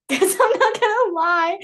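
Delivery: high giggly voice; laughing. Real, not acted. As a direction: laugh through it. Laughing at own story.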